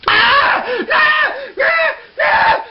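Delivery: short high shrieks; repeated loud, high yelps of pain; cries of pain. Retold or imitated. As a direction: yelp sharply as if it hurts. Retold cries of pain while eating.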